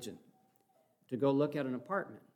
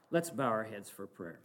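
ordinary delivery

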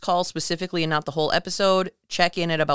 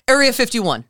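mock caller blurt; 7 semitones higher, 7 dB louder than usual. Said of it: "the guy just goes".

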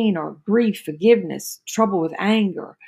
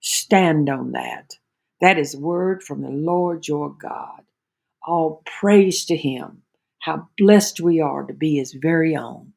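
slow, lower closing blessing; closing prayer; 4 semitones lower, slower than usual. Real, not acted. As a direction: bless them slowly and steadily.

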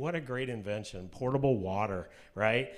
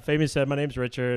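ordinary talk

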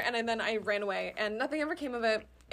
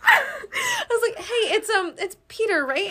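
giggling through a high, flustered question; laughing nervously; 13 semitones higher, 5 dB louder, slower than usual. Retold or imitated. Checking the crush's name, high nervous laughing.

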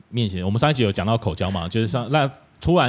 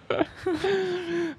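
high soft giggle; 7 dB quieter than usual. Real, not acted. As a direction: giggle at the mixup. Host laughs.

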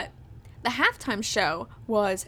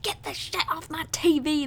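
mock grumpy elder; 6 semitones higher than usual. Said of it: "older generation people like".

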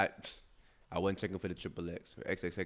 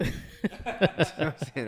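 laughing; 7 semitones higher, much slower than usual. Real, not acted. Laughing at Rudy's joke.

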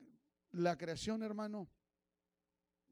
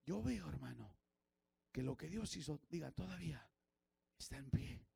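ordinary talk

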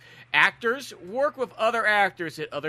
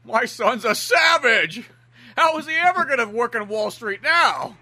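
high, drawn-out dramatic wail; mock distraught father; 5 semitones higher than usual. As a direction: cry it out in hammed-up despair. Acting the distraught movie father.

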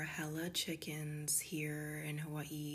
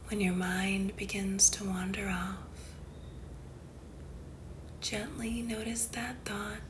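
guided meditation; 3 semitones higher, 3 dB louder than usual. Acted. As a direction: guide the listener unhurriedly into calm, phrase by phrase.

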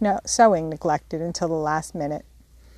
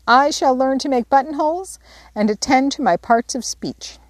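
higher, grand little vow; heroic declaration; 6 semitones higher, 3 dB louder than usual. Acted. Amy following her sisters' example, grandly declaring. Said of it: "heroically declaring".

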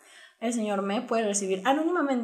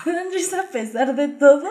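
laughing; 4 semitones higher, 8 dB louder than usual. Real, not acted. Laughing at a funny memory.

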